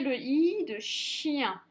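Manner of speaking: "I" held long, then "chien" drawn out; prolonged I. Shown as a demonstration. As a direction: slowly, stretch the i sound in "le I de chien". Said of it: "on le souffle un peu". (« le I de chien », le i tiré en longueur)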